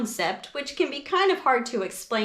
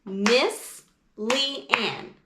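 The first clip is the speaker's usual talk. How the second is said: syllables clapped. Shown as a demonstration. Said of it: "it would sound like this".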